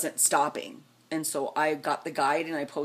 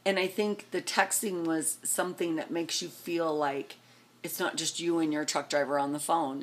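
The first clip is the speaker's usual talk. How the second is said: ordinary talk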